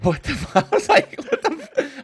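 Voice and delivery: high, loud laughing exclamation; laughing disbelief. Retold or imitated. Producer reacting to the voice memo, laughing disbelief. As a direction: crack up mid-word in mock shock.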